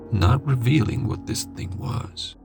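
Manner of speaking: plain reading voice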